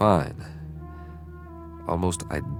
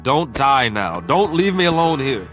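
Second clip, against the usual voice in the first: louder plea climbing in pitch; desperate plea; 3 semitones higher, 6 dB louder than usual. Acted. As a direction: beg a dying friend, panic rising. Begging dying crewmate to live.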